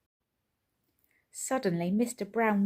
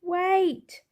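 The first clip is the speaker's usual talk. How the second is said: much higher, louder shout; 11 semitones higher, 6 dB louder than usual. A narrator's reading. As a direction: yell it after the big wheel. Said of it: "shouted Mister Brown".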